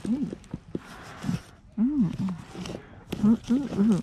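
a string of delighted oohs; excited ooh. Real, not acted. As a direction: coo with delight at a find. Excited find at an estate sale.

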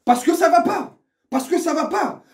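high, loud repeated protest; angry protest. Real, not acted. Fan protesting things are going wrong, exasperated shout. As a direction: cry out in exasperation, twice.

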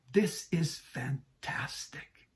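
slow, breathy whisper of wonder; awed whisper. Real, not acted. Pastor marvels to the congregation in an awed whisper. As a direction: whisper it in awe.

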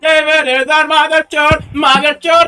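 high, loud, repetitive jeer; shouted taunt. Real, not acted. Prankster abusing support agent David, loud taunting. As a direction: holler it like a chant. Prankster hurls repeated abuse down phone.

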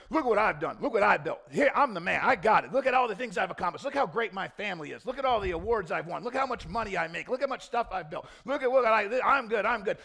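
loud, cocky boasting, puffed up; hammed-up loud boasting of a proud man; mock braggart. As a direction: play the self-satisfied show-off. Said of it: "The proud in here".

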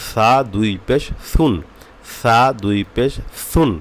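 "সা" and "সুন" lisped thin, as "tha, thun"; tongue tip to upper teeth on "সুন". Shown as a demonstration. Teacher showing the thin tongue-tip letter, lisped "সুন". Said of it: "পাতলা হবে".

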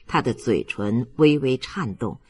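plain reading voice

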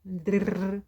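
дрррр with a long rolled р; trilled drrr. Shown as a demonstration. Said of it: "від звука Д". (довге розкотисте дрррр)

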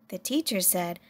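plain reading voice

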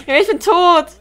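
high, loud cry of defeat; exasperated shout. Real, not acted. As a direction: yell it out as you die. Dies in game.

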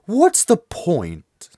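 a drawn-out, badly faked British accent. Streamer voicing the transfer student, bad British accent. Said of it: "I have to go with a bad British accent".

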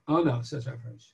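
usual speaking voice

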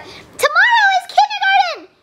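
put-on high, excited little girl exclaiming; excited doll. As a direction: squeal out the big news. Doll announcing first school day.

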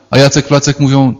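normal talking voice

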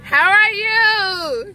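high, slow, loud excited greeting; excited holler. Real, not acted. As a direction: draw it out with delight. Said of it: "I'm sorry for the hollering".

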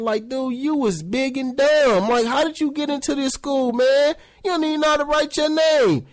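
high, fast incredulous ribbing; mocking disbelief. Real, not acted. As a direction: razz a dumb classmate. Mocking old classmates.

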